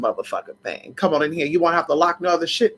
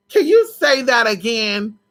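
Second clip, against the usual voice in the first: emphatic repeat; 6 semitones higher than usual. Real, not acted. Repeated with cry, slower.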